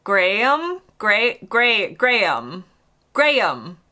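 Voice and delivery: Graham drawn out as Gray-um; Graham as Gray-um, tentative; attempted British Graham. Shown as a demonstration. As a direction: practise a stretched Graham. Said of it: "draw it out more".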